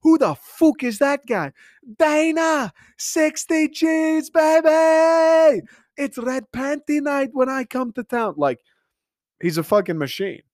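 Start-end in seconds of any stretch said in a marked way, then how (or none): 0.0-8.3 high-pitched hammed-up Irish hype man; Conor McGregor impression. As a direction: crow it high, in a mock Irish accent, stretching the big words. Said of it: "How many iconic sayings does this dude have".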